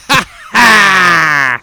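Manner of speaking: loud villain laugh, drawn out; heel laugh. Pretend. Villain gloating over his own badness, loud staged laugh. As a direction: finish with a big staged heel cackle. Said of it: "great fucking late eighties heel laugh".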